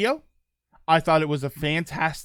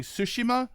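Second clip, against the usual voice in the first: Tsushima stressed on the opening TSU; Tsushima said as TSU-shi-ma; first-syllable stress; 7 semitones higher, 3 dB quieter than usual. Retold or imitated. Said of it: "putting the stress on the first syllable".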